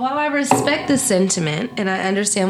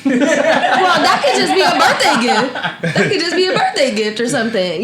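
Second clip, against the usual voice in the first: laughing, louder words; 4 semitones higher, 5 dB louder than usual. Real, not acted. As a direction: laugh while you answer. Laughing answer.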